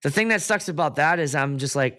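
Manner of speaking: normal talking voice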